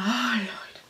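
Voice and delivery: hushed, breath-only whisper; low whispered exclamation; whispered dismay. Retold or imitated. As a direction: whisper it in mock shock. Aghast aside to emotional moment.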